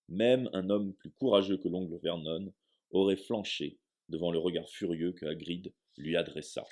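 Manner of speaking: plain reading voice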